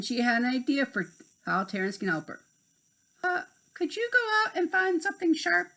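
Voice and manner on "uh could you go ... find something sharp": high, quick fairy voice; Tink's voice. A narrator's reading. Tink sending Terrence away on an errand, quick high voice. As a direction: ask it fast and high.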